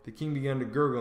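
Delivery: plain reading voice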